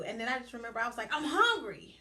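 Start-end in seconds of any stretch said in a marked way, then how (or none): 1.1-1.9 high, loud burst on "I'm hungry"; emphatic outburst. Retold or imitated. Frustrated client finally answers her coach, loud outburst. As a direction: blurt it out at full force. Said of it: "Like that".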